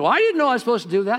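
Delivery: high, loud, rushed self-defence; mock protesting excuse. Believer pleads ignorance to God at judgment, mock protesting. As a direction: blurt a hammed-up excuse at high pitch.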